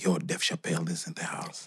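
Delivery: hushed whisper, breath only. Retold or imitated. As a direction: lean in and whisper the news. Friend whispers news before stage.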